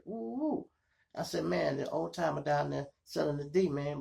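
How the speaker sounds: ordinary talk, nothing marked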